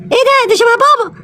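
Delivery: a small cub peering at a shape; curious little cub. Acted. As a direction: cub spots dad, high and curious. Cub spots father approaching.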